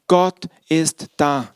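slow and weighty, each word set down; slow, solemn statement. Real, not acted. Preacher to congregation, slow solemn affirmation. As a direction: let it land slowly for the congregation. Preacher restates his first point.

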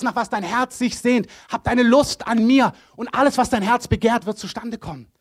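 loud, high, impassioned voice of a loving God; love in person. Acted. God as love in person promising the best life, impassioned. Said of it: "spricht hier die Liebe in Person, die sagt".

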